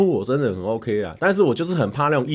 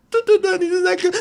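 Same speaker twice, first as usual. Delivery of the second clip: mock eager fan. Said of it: "我说".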